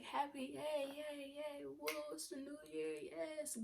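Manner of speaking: high, bubbly mock cheering; mock excited cheer. Girl retelling her New Year's cheer in church, high and giddy. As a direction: squeal it like a giddy kid at midnight. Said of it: "I'm over here like".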